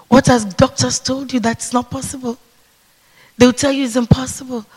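ordinary talk

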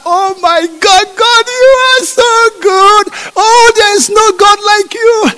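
high, loud, almost crying praise; ecstatic tearful praise. Acted. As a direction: overflow with thanks at the rock. Israelites praise after water.

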